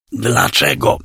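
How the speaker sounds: hoarse croaking whisper; croaked. A narrator's reading. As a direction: croak the word out, voiceless. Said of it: "zaskrzeczałem raz jeszcze".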